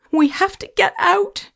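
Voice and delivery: very high, urgent yell; panicked yell. A narrator's reading. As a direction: yell it high, desperate to escape. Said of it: "she yelled".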